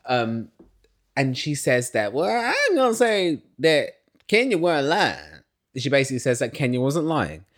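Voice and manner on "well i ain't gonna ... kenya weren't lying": high, put-on country accent. Telling Don Juan about Kenya, mock country accent. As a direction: imitate a country speaker with a high, exaggerated twang. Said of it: "[name] gives this real country accent".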